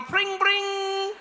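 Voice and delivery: high, ringing bell imitation; cheerful bike bell. Goofy cyclist having fun, imitating a little bell. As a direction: sing out a happy little bicycle bell. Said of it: "with the little bell".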